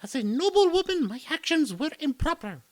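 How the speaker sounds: high, formal and sorry; high noblewoman voice, apologetic. Acted. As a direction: contrite young aristocrat.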